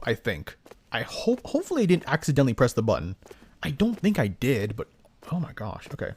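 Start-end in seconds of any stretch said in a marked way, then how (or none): none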